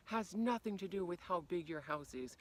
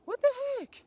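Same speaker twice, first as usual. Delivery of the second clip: much higher, louder mock-indignant cry; offended outburst; 13 dB louder than usual. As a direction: burst out like you've been insulted. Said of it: "offensive and be like".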